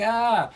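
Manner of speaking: high, loud shriek of mock frustration; a high yelp poking fun at herself; mock frustrated shriek. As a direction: let out a brief mock scream. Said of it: "this isn't me, like, being like".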